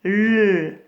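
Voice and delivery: L held long as "lll"; isolated L sound. Shown as a demonstration. Teacher asking where the sound is, L held long. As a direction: say only the consonant L, lengthened. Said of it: "on entend le son".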